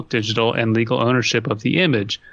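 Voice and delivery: normal talking voice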